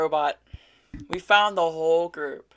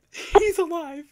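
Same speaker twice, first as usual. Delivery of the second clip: overjoyed; 10 semitones higher, slower than usual. Real, not acted. Overjoyed recognition.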